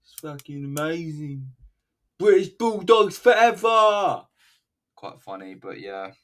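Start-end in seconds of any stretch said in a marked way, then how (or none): 0.0-1.5 high-pitched, badly faked wrestler voice; Ozzy. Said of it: "I can't do an Aussie voice". 2.2-4.3 high, drawn-out triumphant shout; Ozzy. Retold or imitated. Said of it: "I can't do an Aussie voice".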